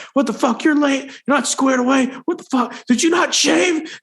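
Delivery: high, barking mock yell; captain yelling. Captain chewing out a late recruit, high angry yell. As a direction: bark it like an enraged captain. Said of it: "this captain's just going off on me".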